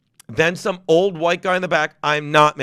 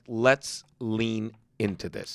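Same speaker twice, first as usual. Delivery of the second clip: slow, low and soft; deliberate; 8 semitones lower, 7 dB quieter, much slower than usual. Retold or imitated. Comic recalls deciding to provoke the room, deliberate. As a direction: draw each word out slowly, low.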